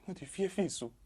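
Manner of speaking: shrill-pitched indignant rebuke; outraged disbelief. Acted. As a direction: shriek the shame at him. Scolding accuser's shamelessness.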